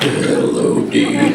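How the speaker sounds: very low rumble outdoing a rival; deep Castiel voice. Retold or imitated. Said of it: "And I was like".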